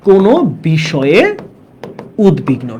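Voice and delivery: slow, broken into pieces for note-taking; dictation pace. Shown as a demonstration. Teacher repeats the meaning for students to write, slow dictation.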